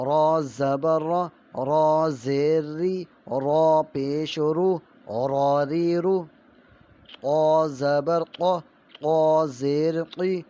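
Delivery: slow, loud sing-song recitation of letter sounds; syllable drill. Shown as a demonstration. Teacher drilling children in Urdu letter sounds, slow and loud. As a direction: chant each syllable slowly like a classroom primer.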